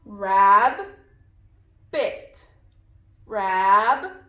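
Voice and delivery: "Rabbit" as rab ... bit, long pause between; split into syllables. Shown as a demonstration. Teacher has pupils count syllables, "Rabbit" pulled apart as rab, bit. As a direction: break "Rabbit" in two, first syllable held. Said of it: "How many syllables are in this word".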